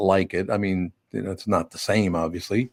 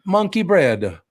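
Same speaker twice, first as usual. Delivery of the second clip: high-pitched little Italian kid impression; Italian accent; 9 semitones higher, slower than usual. Imitating the two-year-old answering what else he wants, Italian accent. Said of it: "Italian Ethan".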